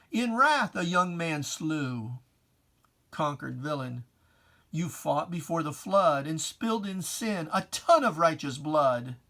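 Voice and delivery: normal talking voice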